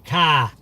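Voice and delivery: "car" said as "cah", no R. Shown as a demonstration. Streamer spelling out the pronunciation, "car" said as "cah". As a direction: drop the R from "car", ending on an open ah. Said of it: "it's pronounced C A H".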